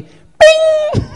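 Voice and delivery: high, loud imitated "bing"; GPS chime. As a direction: sing out a piercing alert ding. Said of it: "I hear".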